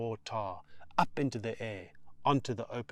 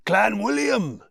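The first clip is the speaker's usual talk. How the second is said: loud, high called place name; shouting; 10 semitones higher, 9 dB louder, slower than usual. Acted. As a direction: call it over the engine roar. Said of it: "shouts Mister Solomon".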